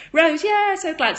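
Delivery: high, loud joyful hello; squealed welcome; excited greeting. Real, not acted. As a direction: greet a friend with delight.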